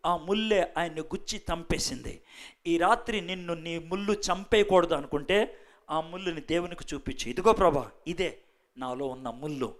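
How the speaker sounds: hushed invitation to confess to God; quiet altar appeal. Acted. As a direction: draw them gently toward confession.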